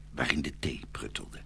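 ordinary delivery, nothing marked

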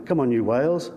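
ordinary delivery

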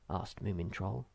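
ordinary delivery

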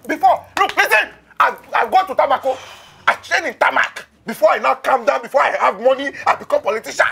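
bully him with boasts; furious rant. Acted. Father rants, threatens suitor.

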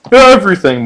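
loud, high shout; yelling. A narrator's reading. Mordecai and Rigby yell at their future kids. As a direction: yell it in total confusion. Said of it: "yelled in unison".

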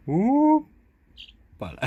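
high, loud, long drawn-out hoppala; playful hoppala. Pretend. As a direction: stretch it out like lifting a baby. Lifting the baby animal up playfully.

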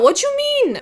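very high-pitched incredulous question; high squawk of disbelief; mock indignant. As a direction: let it soar in playful outrage. Indignant reply, wolfing out.

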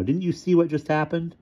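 high, indignant question; angry demand. A narrator's reading. Ludwig rebukes unimpressed Tycho, indignant. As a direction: challenge him, cross and high-pitched. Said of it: "angry now".

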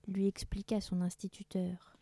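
usual speaking voice

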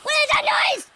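high, frightened outcry; high-pitched startled shout; alarmed yelping. Real, not acted. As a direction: cry out in panic. Scared by noise.

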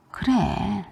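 plain reading voice

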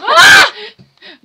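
very loud, high outburst; high-pitched burst of noise, full volume; sudden shriek. Real, not acted. Outburst during play.

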